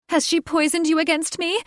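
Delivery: high, loud accusing cry; furious bird woman. Acted. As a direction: screech it at the man she loves. Catherine pleading, enraged.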